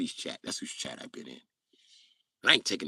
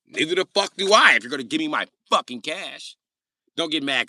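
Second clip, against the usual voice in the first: louder, higher street demand; loud Jacob demand; 7 semitones higher, 7 dB louder than usual. Retold or imitated. Jacob demanding cash from the debtor. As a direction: bark it at the debtor.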